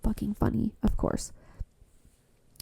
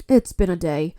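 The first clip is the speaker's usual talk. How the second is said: ordinary delivery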